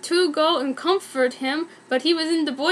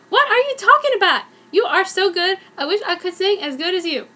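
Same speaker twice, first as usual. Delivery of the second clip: higher, fast, gushing disbelief; excited gushing; 5 semitones higher, faster than usual. Acted. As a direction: gush over her like a thrilled friend. Rachel gushes in disbelief.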